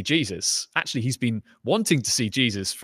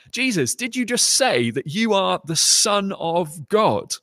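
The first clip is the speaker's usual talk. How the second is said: high, slow, astonished disbelief; incredulous question; 7 semitones higher, 3 dB louder than usual. Acted. Council members stunned by Jesus's claim, slow high disbelief. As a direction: hang on each word in shock. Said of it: "You get this sense of excitement".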